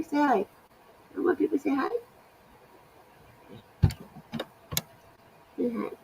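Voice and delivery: high cooing to her cat. Real, not acted. Coaxing pet to greet viewers.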